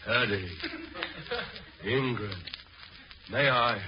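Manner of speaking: drowsy mumbling in sleep; sleep talking. Acted. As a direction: murmur dreamily, half asleep. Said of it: "He's talking in his sleep".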